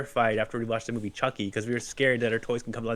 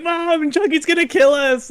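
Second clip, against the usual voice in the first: loud, high, stretched-out cry for help; hammed-up frightened child's shout; panicked kid yelling; 8 dB louder than usual. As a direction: holler for mom in mock terror. Retold child calling to mom.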